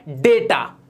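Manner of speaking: डेटा with "ay", "DAY-ta"; correct pronunciation. Shown as a demonstration. Teacher models for learners, डेटा said "day-ta". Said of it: "ये होता है".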